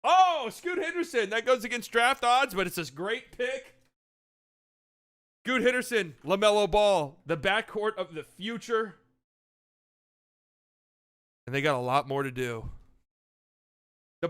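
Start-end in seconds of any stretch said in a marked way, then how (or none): 0.0-9.0 higher, louder hyped-up draft host; draft broadcast host. Pretend. Hosting the mock draft for friends, hyped broadcaster. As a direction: sell each pick like live TV.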